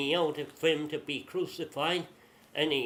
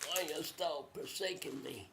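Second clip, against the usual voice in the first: hushed, acted lament; soft anguished plea; 7 dB quieter than usual. Jesus on the cross to his Father, hushed plea. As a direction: plead softly, as if dying.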